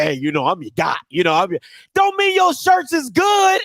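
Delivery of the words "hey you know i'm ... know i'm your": low, dopey salesman buddy; low, lazy pitch as a fake friend; mock homeboy seller. A homeboy selling bad shirts, in a low dopey voice. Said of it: "he'd be like".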